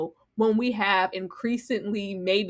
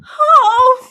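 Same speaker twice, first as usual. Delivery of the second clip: usual speaking voice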